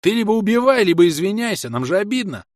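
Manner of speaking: high, loud aggrieved shout; indignant yell. A narrator's reading. Enemy player shouting his grievance at the hero, indignant yell. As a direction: yell it out, offended.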